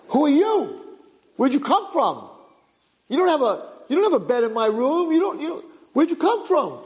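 high-pitched, startled demands; acted indignant alarm; alarmed questioning. Boy startled by stranger in room.

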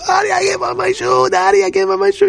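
put-on high, whining child in distress; panicking little girl. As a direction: wail it like a frantic nine-year-old. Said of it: "nine-year-old where everybody's leaving and she's missing her shoe".

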